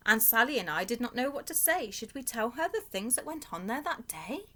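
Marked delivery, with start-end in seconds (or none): none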